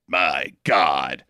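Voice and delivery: low, slow mock solemnity; mock awed crew. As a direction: drop low for an awestruck reaction. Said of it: "and they're like".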